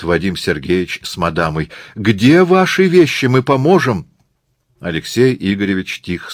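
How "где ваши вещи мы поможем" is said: high, loud and urgent; eager offer. Acted. Couple rush to academician's aid, eagerly loud. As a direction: call out, falling over yourself to help.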